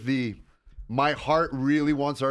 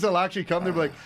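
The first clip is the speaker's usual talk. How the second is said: ordinary talk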